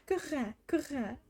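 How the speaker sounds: put-on high playful coo for a baby; baby talk sound. Shown as a demonstration. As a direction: repeat it like cooing at an infant. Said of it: "you'll go like".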